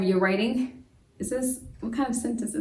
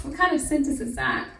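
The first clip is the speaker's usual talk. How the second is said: usual speaking voice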